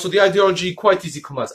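usual speaking voice

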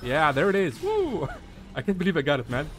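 loud triumphant whoop, breaking into a laugh; excited cheer. Real, not acted. Player cheering after finally claiming the P Y, excited. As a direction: celebrate a win loudly, pitch leaping on the woo. Claims the capture point in game.

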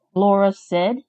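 usual speaking voice

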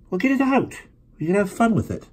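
lightly voiced eager boy; eager kid brother. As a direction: push him with boyish glee. James eager suggestion.

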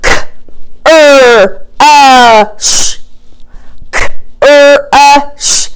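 K, er, uh, sh sound by sound; chopped word. Shown as a demonstration. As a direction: chop the word into single sounds. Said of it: "I'm gonna chop out a word".

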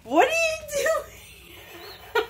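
laughing shocked squeal at the dog; caught him red-handed. Real, not acted. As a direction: drag it out, half laughing at the thief. Dog caught eating the toast.

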